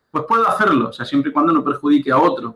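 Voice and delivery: ordinary talk, nothing marked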